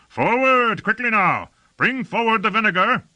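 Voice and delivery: high, urgent shouted orders; shouting. A narrator's reading. Lord urges troops through the foam, shouting. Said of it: "shouted Lord Fayed".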